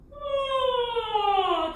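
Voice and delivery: high, loud yawning sigh. Shown as a demonstration. Choir leader demonstrating a yawn sigh to the students. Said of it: "kind of like a yawn sigh".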